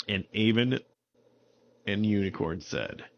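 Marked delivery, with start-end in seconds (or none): none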